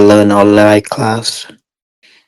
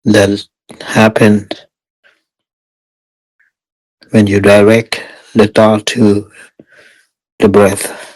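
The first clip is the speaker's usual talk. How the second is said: guided meditation. Real, not acted. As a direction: guide meditators step by step, unhurried and even.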